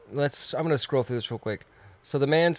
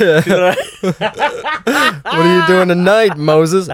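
laughing mock dialogue; 5 semitones higher, 9 dB louder, slower than usual. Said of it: "quoting Moses, laughing".

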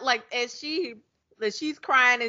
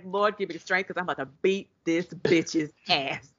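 lower-voiced, muttered mock prayer; 4 semitones lower, 4 dB quieter than usual. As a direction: pray for strength under your breath, half in jest. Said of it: "like she was praying".

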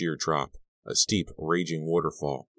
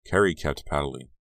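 plain reading voice

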